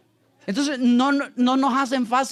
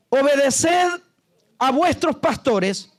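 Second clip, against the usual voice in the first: loud, slow, raised preacher's reading; scripture declamation; 4 semitones higher, 3 dB louder, much slower than usual. Acted. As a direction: proclaim the verse word by word, pitched up. Reads scripture aloud.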